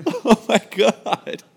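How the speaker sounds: high, slow, laughing it out; words squeezed through real laughter. Real, not acted. Retelling the bumped kid to friends, laughing hard.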